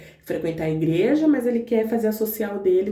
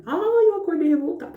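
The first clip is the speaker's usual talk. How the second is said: higher, dragged-out whine on "Ai"; whiny complaint; 8 semitones higher than usual. Retold or imitated. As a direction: moan it like a grumpy morning. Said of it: "eu falei". (queixa manhosa e exagerada)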